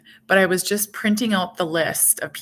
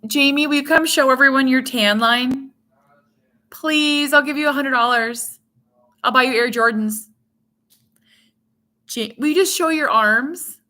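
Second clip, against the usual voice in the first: calling out; 5 semitones higher, 5 dB louder than usual. Real, not acted. Calling out to husband off-camera.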